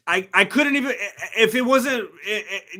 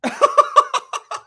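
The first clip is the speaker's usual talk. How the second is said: shrieking laugh, much higher and louder; high burst of laughter; 5 dB louder than usual. Real, not acted. Streamer cracking up at the verdict, squealing laugh. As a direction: let a squealing cackle out. Burst after mock rant.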